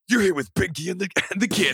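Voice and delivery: punchy FM rock jock intro; rock radio DJ. Pretend. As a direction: hype the show like a rock DJ. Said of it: "like, a ninety-eight Rock sound".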